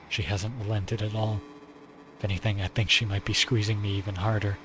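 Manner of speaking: usual speaking voice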